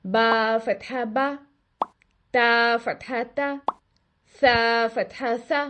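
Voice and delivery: slow, clear open-mouth "बा फतहा बा"; each syllable drawn out slowly with an open "आ"; correct fatha. Shown as a demonstration. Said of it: "ये तो होगी इसकी दुरुस्त अदायगी". (फतहा की सही अदायगी, धीरे और साफ़)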